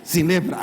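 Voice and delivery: SIN LEPRA answered loudly, below the question; playful answer. Acted. Moisés repite el milagro. (respuesta juguetona, "sin lepra")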